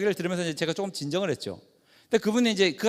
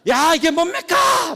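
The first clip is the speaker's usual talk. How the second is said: slow, much higher and louder yell; angry yell; 9 semitones higher, 6 dB louder, slower than usual. Retold or imitated. Angry visitor roaring at the nurses, slow yell. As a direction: drag out each word in a roaring demand. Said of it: "소리를 크게 내리-, 내지르고".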